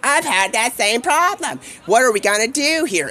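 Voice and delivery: a strange high creature voice, dragged out; Mister Zilla voice. Retold or imitated. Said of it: "Mister Zilla's like".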